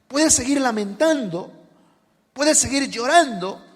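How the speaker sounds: full-force preaching. Acted. Preacher urging the congregation to rejoice, loud and slow. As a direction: build it up and urge them to rejoice.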